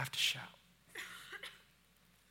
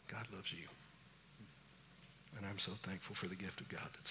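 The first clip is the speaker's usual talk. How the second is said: much softer, lower private words; quiet personal aside; 4 semitones lower, 8 dB quieter, faster than usual. Real, not acted. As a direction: speak gently to one person up front.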